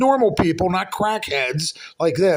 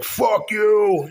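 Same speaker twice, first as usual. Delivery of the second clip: higher, drawn-out mock holler; retold yell; 6 semitones higher than usual. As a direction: act out yelling it through the floor. Retelling his shout through the floor.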